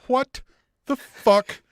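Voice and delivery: brute getting indignant; mock Lance Archer. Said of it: "Lance Archer's like".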